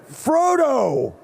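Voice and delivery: a high comic shout of the name; mock shout. Teasing his friend on stage, a high mock shout. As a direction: blurt it out like a confession. Blurts the role he wanted.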